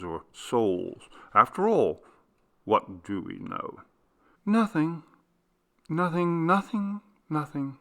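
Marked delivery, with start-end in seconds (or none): 4.4-7.8 slowly. A narrator's reading. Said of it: "said Miss Dean slowly".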